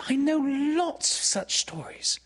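very high, eager coaxing; entreating. A narrator's reading. Wendy tempting Peter to stay, high entreaty. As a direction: tempt him with it in a high girl's voice. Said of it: "she entreated".

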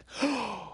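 sharp indrawn gasp; gasp, taken by the idea. A narrator's reading. Wendy tempted by Peter, high gasp.

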